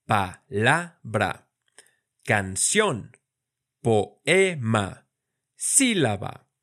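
"Palabra" slow, pressing the stressed syllable; stressed syllable punched. Shown as a demonstration. Teacher showing where each word's stress falls. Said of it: "con mayor fuerza".

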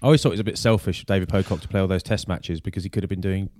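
ordinary delivery

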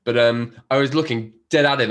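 ordinary talk

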